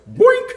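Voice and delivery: loud, very high cartoon poke noise; mimicked boink. Retelling a guy poking his eyes, high comic boink. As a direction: squeal out a comic poking sound.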